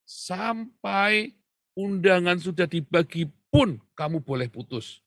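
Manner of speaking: high, loud, insistent ruling; emphatic advice. Retold or imitated. Aunt warning the young couple, high and loud. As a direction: drive the rule home loudly. Aunt's firm advice recalled.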